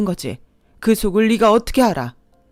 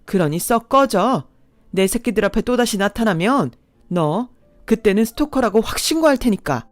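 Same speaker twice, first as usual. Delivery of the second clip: fierce protective anger; 3 dB louder than usual. Acted. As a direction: defend her children, fierce. Mother defends her daughter.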